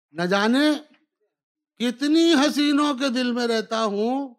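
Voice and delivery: rising, declaimed couplet; poetry recitation. Acted. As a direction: declaim it to a live audience. Poet reciting verses at mushaira.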